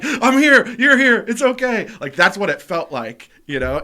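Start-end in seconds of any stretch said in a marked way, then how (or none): 0.0-1.9 high, loud, emotional comforting; hammed-up relief, nearly squealed; overwrought reassurance. Two high friends hugging, overwrought reassurance.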